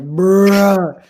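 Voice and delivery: loud drawn-out groaned bruh; long, loud retold groan; mock exasperation. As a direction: blurt it out in comic dismay. Acted reaction to waking up broke.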